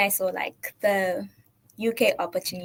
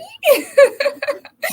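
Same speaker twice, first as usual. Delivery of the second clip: high loud laugh; a real high laugh; 10 dB louder than usual. Real, not acted. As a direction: laugh at yourself. Laughing at own answer.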